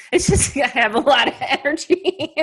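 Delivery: high-pitched, laughing through the words; real giggly admission; can't help laughing while talking. Real, not acted. Laughs while admitting restless energy.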